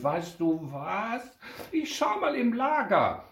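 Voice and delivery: drawn-out question, then high, loud offer; perky saleswoman hitting on a solution; bright idea. A narrator's reading. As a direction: announce a helpful idea, rising high. Assistant has a helpful idea.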